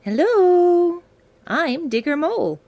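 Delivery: high, loud excited hello; cheerful greeting. Acted. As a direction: greet the kids with big energy. Mole introduces himself to listeners.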